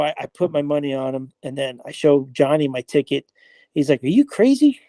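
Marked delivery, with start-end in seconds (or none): 4.0-4.8 high, disbelieving mock question; imitating a friend's disbelief; incredulous. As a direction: scoff like he can't believe it. Friend mocks the long-shot bet.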